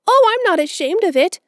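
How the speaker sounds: put-on high, loud, gushing girl's voice; a romantic child longing for a grand name; dreamy orphan girl. A narrator's reading. Orphan girl to Marilla, gushing high and loud. Anne's voice.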